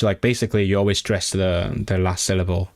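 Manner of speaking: ordinary talk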